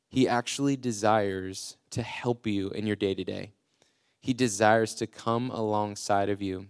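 ordinary delivery

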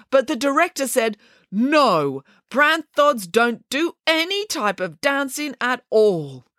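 high, lightly voiced bear, hurt; a sensitive bear explains himself, aggrieved; bear character. Bear complaining to the family about the dull scene.

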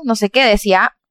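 ordinary delivery

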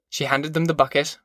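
usual speaking voice

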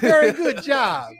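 high, slow Brazilian English praise; hammed-up Brazilian coach voice; Brazilian accent. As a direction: stretch it out in a mock Brazilian accent. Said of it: "I don't know why that's his accent".

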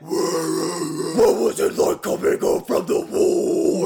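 loud, slow, shouted question; mock scream. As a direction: bellow the question in jest. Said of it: "if you want me to just scream my questions".

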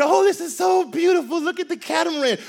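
high, gushing tourist voice; a tourist cooing at the view from the boat. Retold or imitated.

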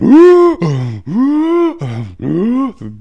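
loud, high, drawn-out wheezing gasps; exaggerated out-of-breath sucking breaths; imitated gasping for breath. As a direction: heave in air like a winded swimmer. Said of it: "breathing like a".

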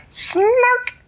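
very high, loud creature squeal; snark call. Acted. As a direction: make a sudden piercing little creature cry. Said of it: "It went like this".

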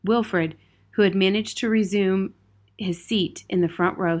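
usual speaking voice

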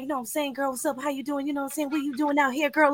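softly imitated laid-back talk with a little swang. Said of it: "talking like [name]".

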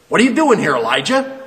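loud, high, sharp accusing question; angry demand. Shown as a demonstration. Preacher voicing God angrily at Elijah, loud and harsh. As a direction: bark it at him like an angry God. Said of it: "I don't think that's how it was said".

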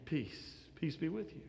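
Jesus greeting frightened disciples, soft and tender; gentle greeting. Acted. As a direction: breathe it out calmly.

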